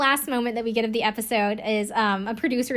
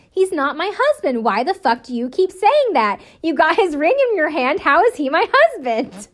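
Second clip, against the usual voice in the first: loud, high mock indignation; exasperated outburst; 6 semitones higher, 6 dB louder than usual. Podcaster acting out a bride arguing with her sister, exasperated mock yelling. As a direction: imitate someone fed up, punching out each question.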